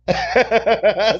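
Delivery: high burst of laughter. Real, not acted. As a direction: crack up at the comment. Laughs at chat comment.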